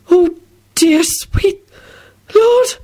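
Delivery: halting, climbing to a high gasp; shock. A narrator's reading. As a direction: gasp it word by word before fainting. Shocked woman about to faint.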